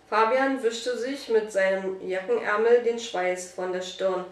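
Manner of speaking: ordinary delivery, nothing marked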